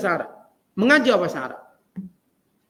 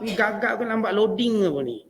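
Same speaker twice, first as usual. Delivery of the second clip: normal talking voice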